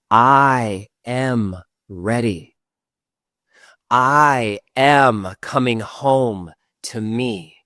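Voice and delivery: slow, firm affirmation spoken out loud. Shown as a demonstration. Guide leads the last round aloud, slow and firm. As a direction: say it strongly and slowly. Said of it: "Last time out loud".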